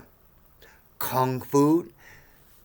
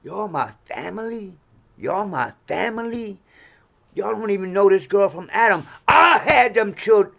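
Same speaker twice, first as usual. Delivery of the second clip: retold anger; 7 semitones higher, 5 dB louder than usual. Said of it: "I'm like".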